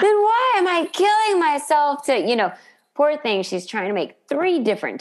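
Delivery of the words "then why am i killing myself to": high, exasperated mock Monica; imitating Monica. Monica fed up with Joey's remark, high squeaky voice. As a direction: squeal it out in disbelief. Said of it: "like, when she goes".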